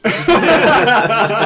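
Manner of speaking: loud, high helpless laugh; high burst of laughter. Real, not acted. Laughing at the Hot Shots gag with cohost, loud high laugh. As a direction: crack up at your own punchline. Cracking up at own story.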